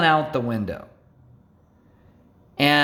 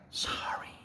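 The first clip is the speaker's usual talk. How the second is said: meek whispered sorry; 17 dB quieter than usual. Retold or imitated. Driver apologizing to the angry mom, whispered sheepishly. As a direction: breathe a tiny sheepish sorry.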